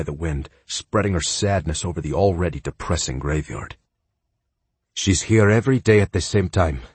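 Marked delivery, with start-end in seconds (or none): 4.9-6.8 lightly voiced Spanish accent. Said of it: "with a heavy Spanish accent".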